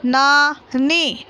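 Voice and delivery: नानी drawn out very slowly, syllable by syllable. Shown as a demonstration. Teacher reading aloud to pupils, नानी stretched very slowly. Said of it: "बोलकर पढ़िए".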